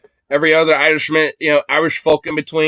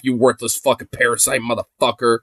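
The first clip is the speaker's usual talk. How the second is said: normal talking voice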